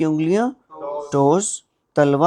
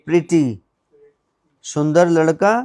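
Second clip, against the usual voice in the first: normal talking voice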